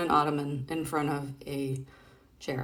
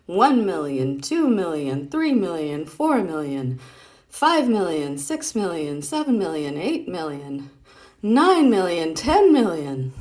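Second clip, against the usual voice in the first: louder counting, loudest at the end; 3 semitones higher, 7 dB louder, slower than usual. Acted. Reading Patty's jump rope count, louder and building. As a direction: count it up like a bragging kid.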